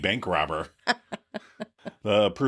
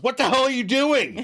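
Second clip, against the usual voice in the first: high, louder furious shout; outraged yell; 13 semitones higher, 4 dB louder than usual. Retold or imitated. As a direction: yell it as a shocked brother.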